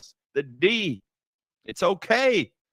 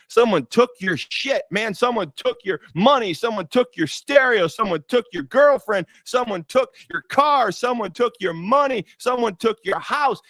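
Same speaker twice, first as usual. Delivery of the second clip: preaching litany; 5 semitones higher than usual. Acted.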